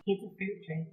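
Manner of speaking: high, cutesy cartoon flapper voice; high-pitched Betty Boop-style waitress. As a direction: play the drink girl as a squeaky cartoon pin-up. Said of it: "Why is she Betty Boop?".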